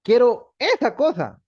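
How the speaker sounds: put-on high, loud, slow childlike demand; vague request. Shown as a demonstration. Teacher acting someone asking vaguely for a thing. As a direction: whine for that thing. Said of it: "alguien nos dice algo y decimos".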